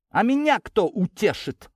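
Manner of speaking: high, drawn-out indignant protest; flaring up. A narrator's reading. Daughter protesting to her father, high and indignant. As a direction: flare up with wounded indignation. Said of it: "взвилась Кристина".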